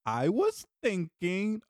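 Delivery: slow coaxing "I was thinking" with a rising end; daughter's coy reply. Retold or imitated. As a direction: drag it out sweetly to set up a request. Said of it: "Oh, well".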